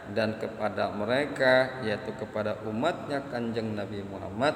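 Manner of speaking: ordinary delivery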